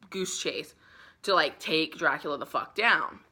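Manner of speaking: ordinary delivery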